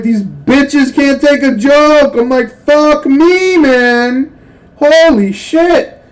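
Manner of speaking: hammed-up yelling at the offended class; mock outraged yell. Retelling the classroom joke, a hammed-up high loud yell.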